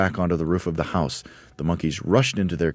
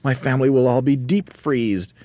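raised, loud, worried wail; Mugglewump's cry; 7 semitones higher, 5 dB louder than usual. A narrator's reading. Mugglewump dreads his family freezing, crying out. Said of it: "cried Mugglewump".

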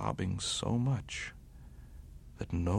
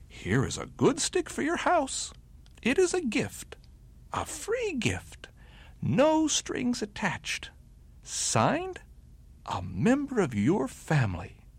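high bunny voice, earnest; proud little bunny; 4 dB louder than usual. A narrator's reading. Bunny's note left for the beaver, high and earnest. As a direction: read the note like a proud small gift-giver.

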